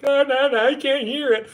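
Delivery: high, loud mocking whine; mock whiny listener. Mocking people who won't hear the facts, high and whiny. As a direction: whine like someone covering their ears. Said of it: "you motherfuckers are like".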